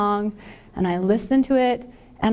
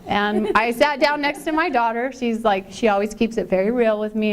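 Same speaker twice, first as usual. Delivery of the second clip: ordinary talk, nothing marked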